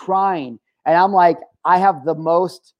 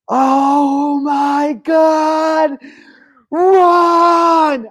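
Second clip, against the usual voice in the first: high, drawn-out terrified scream of a warning; blood-curdling scream; 11 semitones higher, 6 dB louder, much slower than usual. Retold or imitated. Stranger screaming a warning to the casino crowd, terrified shriek. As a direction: shriek it like your life depends on it. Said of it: "a blood-curdling, uh, scream".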